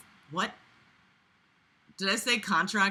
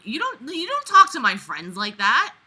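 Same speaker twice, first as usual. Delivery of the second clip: ordinary talk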